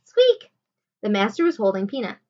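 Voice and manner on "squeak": very high, loud mouse squeak; sudden high squeak. A narrator's reading. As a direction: pipe up like a tiny mouse. Mouse squeaks in master's hand.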